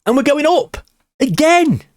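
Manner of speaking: high, elated call; excited cheer. Real, not acted. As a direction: announce the promotion with joy. Celebrating promotion spot.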